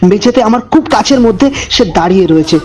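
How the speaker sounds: low, hushed storyteller voice; eerie narration, measured and dark; horror story narration. A narrator's reading. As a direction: draw the listener into a creepy tale.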